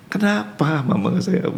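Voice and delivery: put-on high small-child voice, hurt; child's voice. Grandfather voicing the grandchild telling her mother she is unloved. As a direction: speak like a little girl who feels unloved.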